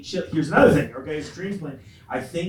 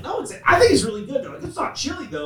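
higher, insistent defense; defending the chili; 12 semitones higher, 6 dB louder than usual. Retold or imitated. Host defending chili to relatives, pitched up. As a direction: plead the chili's case, pitched up. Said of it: "I'm like".

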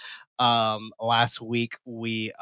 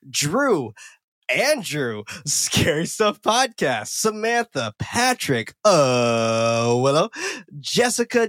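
high, swooping lilt on each name; shout-out roll call; 5 semitones higher, much slower than usual. Pretend. Host thanks patrons by name, playful sing-song roll call. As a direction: bounce through the list, lifting every name.

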